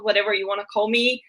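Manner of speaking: ordinary talk, nothing marked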